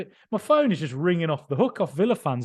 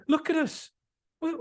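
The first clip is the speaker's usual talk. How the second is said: ordinary delivery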